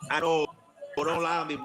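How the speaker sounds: ordinary talk, nothing marked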